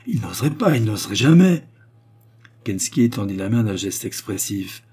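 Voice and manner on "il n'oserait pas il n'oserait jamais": louder, higher, building horror; horrified cry. A narrator's reading. La princesse refuse d'imaginer le meurtre de son père, horrifiée. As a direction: cry out in disbelief. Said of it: "s'écria-t-elle avec horreur".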